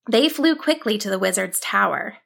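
usual speaking voice

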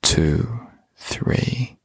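whispered count; whispering. Shown as a demonstration. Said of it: "exhaling to the count of three".

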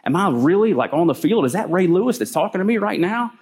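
can this be real, wide-eyed; stunned disbelief. Retold or imitated. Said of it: "He's gotta be like".

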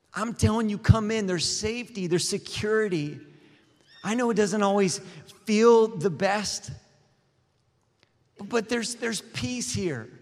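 high, loud, pleading preacher; impassioned preaching. Real, not acted. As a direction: plead with them, voice soaring.

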